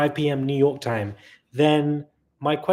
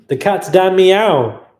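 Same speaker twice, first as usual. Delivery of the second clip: delighted shout; 6 semitones higher, 11 dB louder, slower than usual. Real, not acted. Reading a funny donor name.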